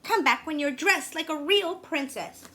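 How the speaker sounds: high, loud disdainful prince; high loud snooty prince. A narrator's reading. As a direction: sneer at her like a spoiled prince. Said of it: "He looked in and said".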